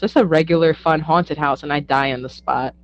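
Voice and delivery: ordinary talk, nothing marked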